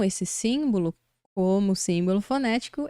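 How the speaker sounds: normal talking voice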